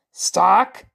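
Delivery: loud bellow as Thor. Acted. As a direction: bellow across the battle. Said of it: "Thor bellowed".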